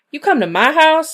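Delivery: furious high shout; snapped. A narrator's reading. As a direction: snap at the devil in a high, loud fury. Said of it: "snapped Maggie".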